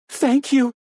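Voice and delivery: high, shaking sobbing thanks; high trembling thanks to the captor. A narrator's reading. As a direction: thank them while trembling. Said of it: "Joyce Ann shook, trembling".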